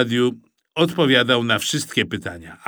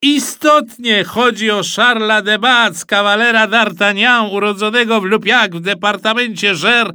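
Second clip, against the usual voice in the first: high, loud triumphant announcement; shouting radio host; 9 semitones higher, 6 dB louder than usual. A narrator's reading. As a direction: proclaim the correct answer to the audience. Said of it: "krzyknął pan z radia". (donośne, wysokie ogłoszenie prowadzącego)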